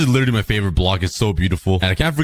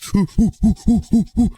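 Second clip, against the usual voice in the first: higher panicked giggle; nervous laugh; 9 semitones higher than usual. Real, not acted. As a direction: laugh as you run from trouble. Laughs while fleeing danger.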